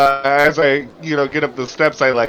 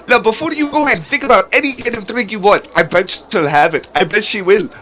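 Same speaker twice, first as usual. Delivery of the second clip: excited character voice; 8 semitones higher than usual. Acted. Barnabas pitching the coffee, voiced.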